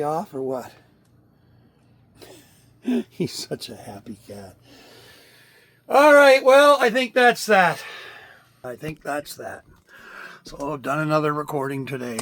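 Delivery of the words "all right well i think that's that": high, loud, drawn-out announcement; loud wrap-up. Real, not acted. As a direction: call it out big and slow. Loud sign-off, then repeated softly.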